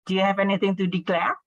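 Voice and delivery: fast question with "declare" going up; slightly rising intonation. Shown as a demonstration. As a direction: let "declare" climb as you ask. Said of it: "So a bit rising in the part".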